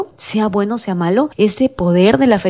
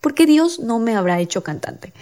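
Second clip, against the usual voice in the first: plaintive questioning; 7 semitones higher than usual. Retold or imitated. Host retelling her complaint about not singing, plaintive questioning. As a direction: plead "why" over and over. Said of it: "Y yo decía".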